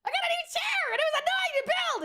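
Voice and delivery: high, excited mock squeal; aunt gushing about her new chair, excited squeal; loud excited aunt. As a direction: squeal it like the overexcited loud one. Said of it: "the loud one that's like".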